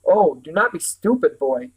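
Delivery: usual speaking voice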